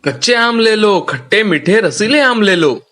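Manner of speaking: high hawker's cry; vendor's call. Acted. Ram calls customers to his stall, hawker's cry. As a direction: call the mangoes to the street. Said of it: "ऐसा वो आवाज दे रहा था".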